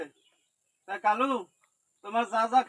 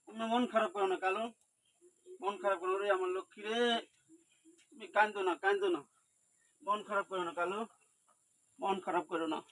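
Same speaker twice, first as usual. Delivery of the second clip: hushed and tender, nearly murmured; soft soothing; 8 dB quieter than usual. Real, not acted. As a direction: comfort a crying pet quietly. Soothing a crying animal softly.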